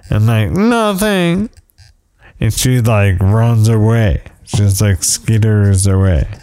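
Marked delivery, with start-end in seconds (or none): 0.5-1.5 very high, hammed-up frightened wife; mock scared. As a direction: imitate a timid squeak before scurrying off. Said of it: "it, it scares her".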